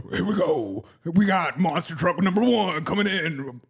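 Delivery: hyped rally announcer voice; monster truck announcer. Pretend. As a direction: call out the trucks rolling in. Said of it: "your best monster truck voice, like you're announcing a monster truck rally".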